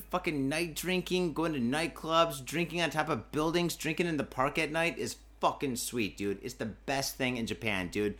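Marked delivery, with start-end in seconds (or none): none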